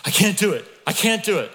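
put-on high, loud, panicky child's wail; panicked child. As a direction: cry it out like a terrified little boy. Son panicking before the play.

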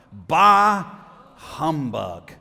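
grumpy miser, loud drawn-out first word; Ebenezer Scrooge. Acted. Preacher quoting the miser's favorite expression, grumpy outburst. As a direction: blurt it loud, then drop away dismissively. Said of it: "His favorite expression was what?".

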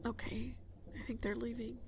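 relieved hushed whisper. Acted. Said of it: "I'm gonna whisper".

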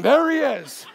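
mock booming accusation across a room; loud, booming, intimidating. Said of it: "I hear this loud, booming voice, intimidating voice".

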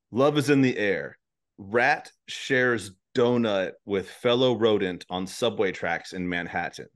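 slow, careful reread of the headline. Shown as a demonstration. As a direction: spell it out slowly for him. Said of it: "you said rats do what up the stairs?".

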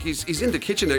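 ordinary delivery

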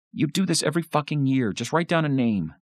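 high, fast and fed up; exasperated outburst. A narrator's reading. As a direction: snap at a dithering brother. Francis throws pencil, snaps at Paul.

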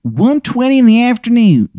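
high-pitched, hammed-up Baltimore accent; cartoonishly high Baltimore accent; terrible Baltimore accent. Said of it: "his terrible accent".

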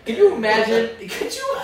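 laughing. Real, not acted. Laughing at own idea.

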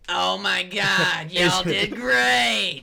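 slow, high, loud excited cry; excited shout. Acted. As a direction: cry it out with joy, slowly. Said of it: "She's like".

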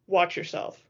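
flat, restrained menace; flat and frightening. A narrator's reading. Edward warning the rival from the corner, flat and frightening. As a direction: warn him with little inflection. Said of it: "His voice was flat and frightening".

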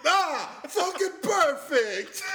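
slow, high, loud delighted groan; satisfied guy. Pretend. The bartender loving his payment, slow loud delight. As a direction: savor it like a gift. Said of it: "He's like".

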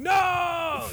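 high mock anguished no; anguished cry. Darwin torn over the mole, high anguished cry.

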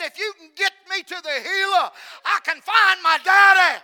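very loud re-enacted plea, swelling at the end; acted desperate shout. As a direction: cry out the girl's plea as a preacher would. Acting the screaming girl's desperate plea.

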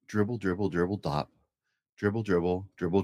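ordinary delivery, nothing marked

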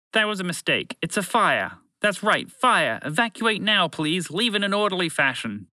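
high-pitched, urgent evacuation announcement; bumbling announcer. Acted. Broadcast voice ordering the group to evacuate, high and urgent. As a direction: sell a made-up fire alarm.

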